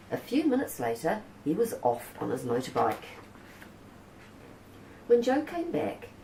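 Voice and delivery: usual speaking voice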